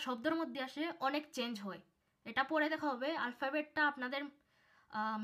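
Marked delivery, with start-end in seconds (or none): none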